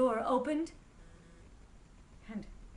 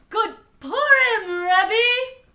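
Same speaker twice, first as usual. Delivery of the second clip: loud, high, drawn-out holiday greeting; joyful outburst; 11 semitones higher, 19 dB louder, much slower than usual. A narrator's reading. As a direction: burst out with joy, calling it out. Sudden joyful greeting in story.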